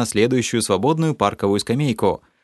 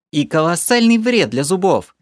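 snorting retort; 8 semitones higher, 3 dB louder than usual. A narrator's reading. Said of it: "фыркнула Елена".

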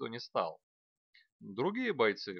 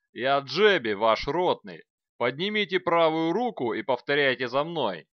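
loud drill sergeant; 4 semitones higher, 11 dB louder than usual. A narrator's reading. Sergeant addressing recruits.

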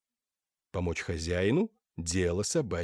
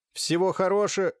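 lightly voiced high tinny speaker; a hint of the metallic voice; 9 semitones higher, 4 dB louder than usual. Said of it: "высокий металлический голос".

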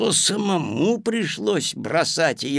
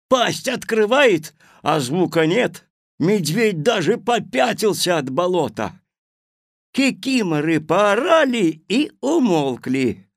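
usual speaking voice

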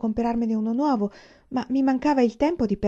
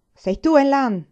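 loud, higher-pitched challenge; shouted question; 6 semitones higher, 8 dB louder, slower than usual. A narrator's reading. Sergeant confronts the student in class, shouting. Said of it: "gridò il sergente".

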